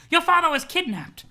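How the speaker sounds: high, loud alarmed granny; grandma breaking bad news; Nana voice. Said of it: "You love my Nana voice?".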